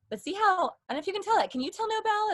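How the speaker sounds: very high, lifted head placement; high, bright-placed demo voice; voice in the face. Shown as a demonstration. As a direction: pitch it way up and let it ring. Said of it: "it's here versus just, like, here".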